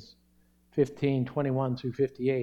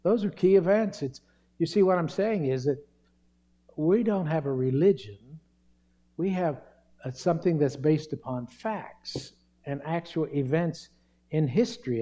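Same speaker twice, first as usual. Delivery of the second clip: ordinary talk, nothing marked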